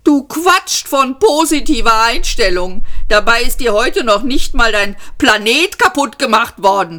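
high, aggrieved lament; indignant, self-pitying wail; wailing complaint. A narrator's reading. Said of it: "jammerte Arthur".

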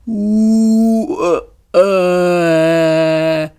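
long, held machine hum; worried robot stalling on drawn-out sounds; uneasy rumbling. A narrator's reading. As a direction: drone out uneasy hesitation noises. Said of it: "brummelte die Maschine beunruhigt".

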